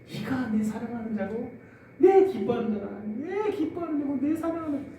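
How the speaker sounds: God speaking from the cloud, proud; proud announcement. Retold or imitated. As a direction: announce it with fatherly pride. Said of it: "막 자랑하는 거예요".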